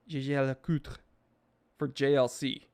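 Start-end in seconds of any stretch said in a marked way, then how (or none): 0.1-1.0 Jaeger LeCoultre said the French way; French pronunciation. Shown as a demonstration. Streamer showing the name he avoids saying, French pronunciation.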